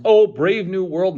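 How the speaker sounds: high, loud, slow proclamation; call to arms. A narrator's reading. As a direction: trumpet it out like a challenge. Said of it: "trumpeted a call to arms".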